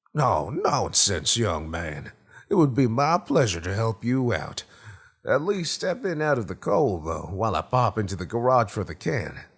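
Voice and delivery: hearty fifties host with a swooping pitch; Gerald Jones, hospitable. Acted. Homeowner waves off payment and urges Tracy inside, in hearty swaggering character voice. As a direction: insist generously, with a touch of old-time swagger.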